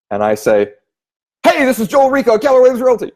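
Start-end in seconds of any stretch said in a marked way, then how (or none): 1.4-3.1 much louder and higher, pushy salesman; overly loud pitch. Shown as a demonstration. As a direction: blast the old lady with hype. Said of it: "out of rapport".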